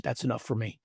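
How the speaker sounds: quietly content, almost murmured; soft smiling reply. Retold or imitated. Son quietly accepting his mother's terms, softly smiling. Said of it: "looked at her and smiled".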